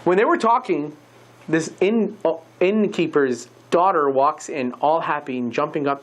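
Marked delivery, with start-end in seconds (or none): none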